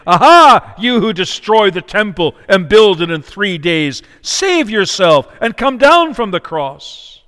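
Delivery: loud, high scornful jeer; hammed-up crowd taunt, with a loud mocking Aha. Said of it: "blasphemed him, wagging their heads".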